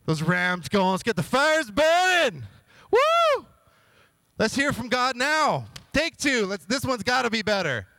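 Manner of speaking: hyped cheer. Retold or imitated. King hyped at the altars.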